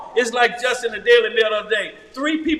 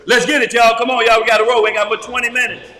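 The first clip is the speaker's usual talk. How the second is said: loud, pressing hurry-up; rally them to get moving; insistent, louder call to hurry; urging on; 5 dB louder, faster than usual. Real, not acted. Hurrying the reader along.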